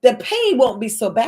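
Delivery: normal talking voice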